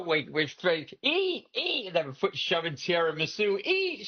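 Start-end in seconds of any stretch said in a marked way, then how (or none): 1.0-1.9 high-pitched pushy relatives; imitated food-pushing. 3.6-4.1 high-pitched pushy relatives; imitated food-pushing.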